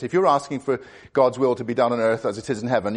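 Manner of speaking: ordinary talk, nothing marked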